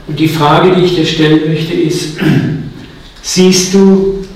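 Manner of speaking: normal talking voice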